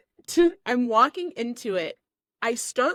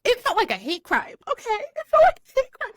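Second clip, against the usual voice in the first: mock outrage, rising higher and louder; high-pitched indignant squeal building; outraged; 13 semitones higher, 3 dB louder than usual. To her boyfriend after the water, outraged and shrill-high.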